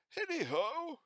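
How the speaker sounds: put-on old Fezziwig calling his apprentices; rich fat jovial voice. A narrator's reading. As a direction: call out like a jolly old master.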